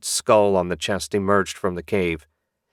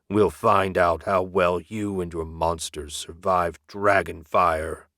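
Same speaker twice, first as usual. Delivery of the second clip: menacing dragon voice. Acted. Dragon threatens Lord of Dead.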